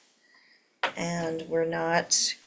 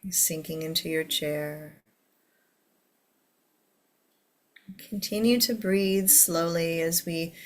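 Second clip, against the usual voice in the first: guided meditation. Acted. Leads a meditation.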